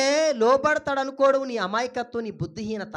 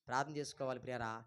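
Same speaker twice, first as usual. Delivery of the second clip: very low, hushed and quick; quiet appeal to the congregation; soft exhortation; 10 semitones lower, 15 dB quieter, faster than usual. Real, not acted. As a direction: drop to a gentle aside. Repeats the exhortation softly.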